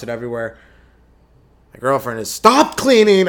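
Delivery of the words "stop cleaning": loud, much higher outburst; sudden hammed-up shout; mock shouted order. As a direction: yell it as a joke command. Acts an order about girlfriend.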